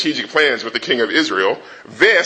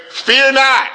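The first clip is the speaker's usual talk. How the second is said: high loud command; 9 semitones higher, 3 dB louder than usual. Acted. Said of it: "Elisha said to the servant".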